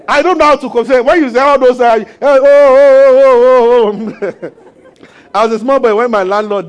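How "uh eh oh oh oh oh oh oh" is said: mock wailing. Imitating graveside mourners wailing.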